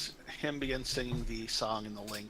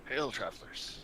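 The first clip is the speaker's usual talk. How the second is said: put-on creaky elderly greeting; a hint of a frail old man. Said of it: "in the voice of a very old man".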